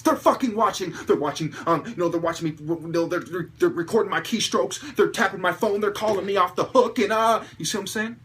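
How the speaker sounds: upset, agitated. Shown as a demonstration. Vlogger shows the upset way to say it, loud and agitated. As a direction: let the anger boil over. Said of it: "What happens when I say it like this?".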